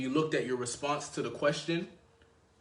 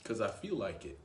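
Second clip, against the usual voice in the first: said through a laugh, low and soft; amused reply; 4 semitones lower, 6 dB quieter than usual. Shown as a demonstration. Man answering a girl's question, laughing it off. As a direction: toss it off with a chuckle. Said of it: "I'll probably just say".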